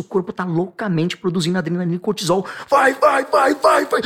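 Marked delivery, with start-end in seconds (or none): 2.7-4.0 shouted, higher and louder, hurrying someone along; frantic shout of urging; urgent shouting. Acted. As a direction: bark go go go like a frantic push.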